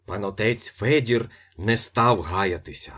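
plain reading voice

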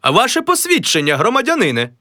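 high, demanding clerk's voice; a high, officious clerk; official. Acted. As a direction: ask for the papers sternly.

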